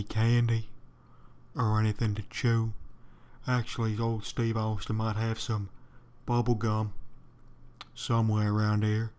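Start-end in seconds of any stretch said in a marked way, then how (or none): none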